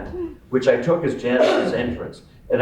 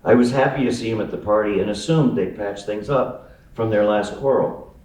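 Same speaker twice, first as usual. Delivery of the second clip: ordinary delivery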